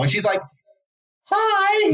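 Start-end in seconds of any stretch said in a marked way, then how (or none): 1.2-1.9 put-on very high little-girl hi; tiny Mary Lou hi. [name] voicing little Mary Lou greeting the Grinch, very high. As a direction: chirp it like a small girl.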